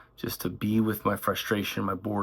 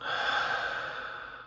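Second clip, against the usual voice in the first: long, unvoiced sighing exhale; 5 dB quieter than usual. Shown as a demonstration. Guide showing listeners how to breathe out, long sigh. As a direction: let the breath out in an audible sigh. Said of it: "sigh it out".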